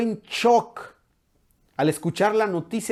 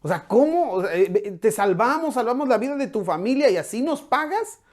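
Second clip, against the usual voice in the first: high, scolding voice of an exasperated general; preacher voicing Joab scolding the king, pitched high; 8 semitones higher than usual. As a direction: play Joab telling David off, up in pitch. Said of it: "y le dice".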